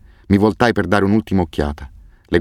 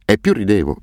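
usual speaking voice

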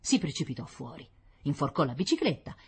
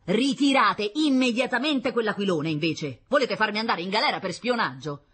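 loud, raised scolding; angry father; 7 semitones higher, 5 dB louder than usual. Acted. Father ordering children to pull in the kite, angry. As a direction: order them sharply.

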